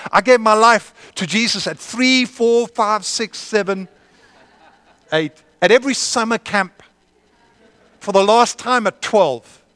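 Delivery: high, loud impassioned preaching; full-force preaching. Real, not acted. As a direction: preach it hard to the room.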